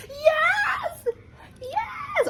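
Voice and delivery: very high squealing yes, yes; mock triumphant yes. Imitating Danielle shrieking with joy at the result. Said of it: "Danielle's like".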